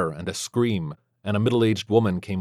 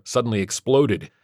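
usual speaking voice

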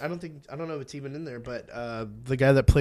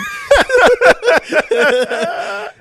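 high laugh; 7 dB louder than usual. Real, not acted.

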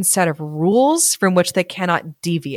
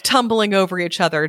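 usual speaking voice

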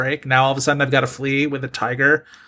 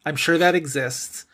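ordinary talk, nothing marked

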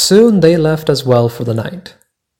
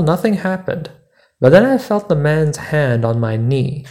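usual speaking voice

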